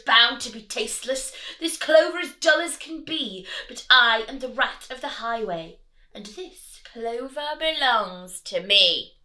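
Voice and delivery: loud, scornful highway rat; bossy rat. Acted. Highway rat ordering the rabbit, loud and scornful. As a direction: order it with a sneer. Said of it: "he ordered".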